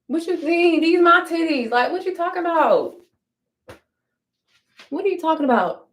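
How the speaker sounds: pitched high in mock outrage; indignant high. Real, not acted. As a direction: act baffled and offended, up high. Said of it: "indignant reaction".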